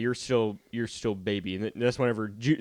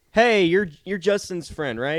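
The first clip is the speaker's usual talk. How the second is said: friendly greeting; 7 semitones higher, 6 dB louder than usual. Retold or imitated. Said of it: "and I'm like".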